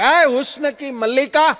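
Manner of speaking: high, loud, slow wheedling beggar; beggar's flattery. Retold or imitated. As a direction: sweet-talk her for ten rupees. Blind beggar flattering the wife.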